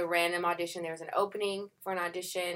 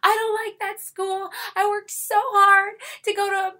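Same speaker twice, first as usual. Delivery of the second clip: mock crying; 9 dB louder than usual. Retelling her upset about the school, hammed-up tearful wail. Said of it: "I cried because I was like".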